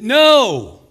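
booming, high, drawn-out NO; loud preacher's cry of denial; emphatic shout. Acted. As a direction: thunder the refusal. Preacher shouts answer to own question.

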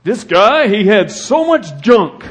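preacher mocking a materialist obituary, lightly voiced. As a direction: scoff at the junk he owned. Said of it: "Read the obituaries".

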